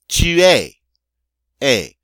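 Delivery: "tu es." loud and high, s silent; French pronunciation. Shown as a demonstration. Teacher shows how to say "you are", loudly modelled. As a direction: punch out "tu es." ending in a bare ay. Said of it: "Notice again the N-, S is not pronounced".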